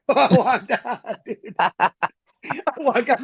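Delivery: talking through laughter; laughing. Real, not acted. Storyteller cracking up over the colonel's cake order, laughing. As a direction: laugh your way through the words.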